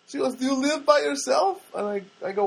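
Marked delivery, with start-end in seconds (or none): 0.3-1.6 high, loud mock girl voice; put-on high-pitched girlish question; young Armenian girl. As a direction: imitate a curious young woman. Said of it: "there's this Armenian girl there".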